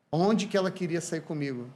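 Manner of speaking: ordinary talk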